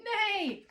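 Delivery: high, loud falling neigh; imitated horse neigh. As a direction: cry it out like a horse. Repeats goose's pretend horse noise.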